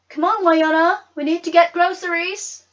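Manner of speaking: high, loud mother pulling her away; firm mother. Acted. Mama calling Wynonna out of the dress shop, firm and loud. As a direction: call her out of the shop firmly.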